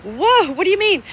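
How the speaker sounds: a startled, laughing whoa; surprised what do you mean. Retold or imitated. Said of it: "And I'm like".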